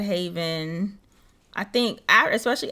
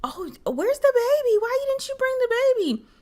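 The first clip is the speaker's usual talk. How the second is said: prying acquaintance. Retold or imitated. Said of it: "I still get that reaction".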